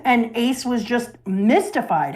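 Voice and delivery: ordinary delivery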